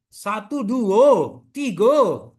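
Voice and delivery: satu, dua, tigo counted the Padang way, with tiga said as tigo and dua loud; Padang accent. Shown as a demonstration. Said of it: "Sama orang Padang juga".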